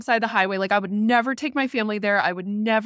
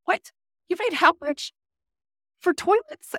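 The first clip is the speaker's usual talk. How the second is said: much higher, disbelieving squeak; dad shocked at the price of toilets, high; incredulous dad; 10 semitones higher than usual. Retold or imitated. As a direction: gasp it like he can't believe it. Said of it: "My dad's like".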